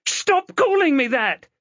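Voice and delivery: high, loud angry yell; girl yelling at the stranger calling her Chloe; angry shout. Acted. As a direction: make him stop, furious. Chloe refuses the name.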